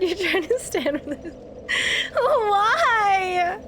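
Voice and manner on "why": high and wailing, half sob half laugh; laugh-crying despair. Real, not acted. Gamer stuck on a boss, whining through tears of laughter. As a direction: whine like it's all too much.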